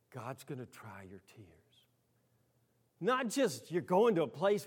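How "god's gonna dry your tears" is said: soft whispered promise. Real, not acted. Preacher whispering a promise to those in tears. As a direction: lean in and whisper the comfort. Tender, hushed sermon moment.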